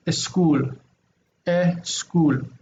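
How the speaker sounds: "eschool" with a Spanish e sound before the s; Spanish accent. Retold or imitated. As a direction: imitate a Spanish speaker saying "eschool". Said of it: "you will also hear them say".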